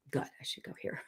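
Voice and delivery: breathy whisper of a gut feeling; whispered inner voice. Retold or imitated. Gut feeling whispering to her. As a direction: whisper it like your gut talking.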